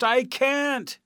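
high, loud helpless call; shouted. A narrator's reading. Balloonist calls down from the rising balloon, shouting. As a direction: shout it down from a distance. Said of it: "shouted the balloonist".

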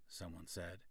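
ordinary delivery

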